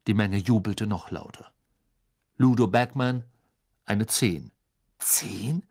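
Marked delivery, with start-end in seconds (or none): none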